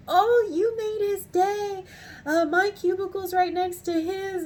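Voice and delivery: high, loud, gushing imitation; imitated gushing delight. Supervisor telling customer she made his worker's day, gushing. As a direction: squeal it as an overjoyed supervisor would. Retold supervisor's happy reaction.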